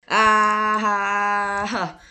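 startled scream. Real, not acted. Wakes from nightmare.

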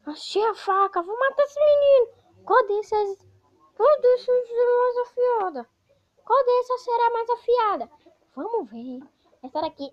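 high, loud villain grabbing knives. Acted. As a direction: squeal like a frantic monster. Voices the villain again.

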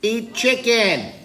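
high, loud, drawn-out protest chant; protesting fish. Pretend. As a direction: chant slogans as a school of fish. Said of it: "they're like".

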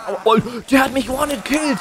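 high, loud startled yell; startled shout. Real, not acted. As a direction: cry out in shock. Killed in game, cries out.

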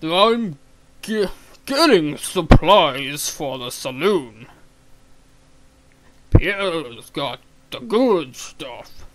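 booming, drawn-out barkeep voice; loud, slow saloon keeper. Acted. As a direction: bellow it slowly like a big saloon owner. Voicing Gus the saloon keeper.

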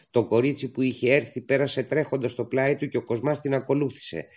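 plain reading voice

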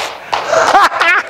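high airy burst of laughter; breathless high giggle; gleeful laugh. Real, not acted. Joyful laugh mid-sermon.

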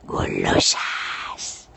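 long, drawn-out playful whisper; teasing whisper. Pretend. Se burla de las seguidoras. (susurro pícaro y alargado)

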